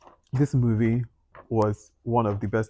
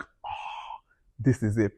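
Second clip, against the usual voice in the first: awed whisper; 5 dB quieter, much slower than usual. Retold or imitated. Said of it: "It was like".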